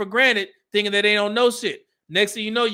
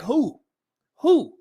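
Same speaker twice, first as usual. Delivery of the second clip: rising owl-like hoot, higher; 4 semitones higher than usual. Retold or imitated. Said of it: "Sounded like an owl".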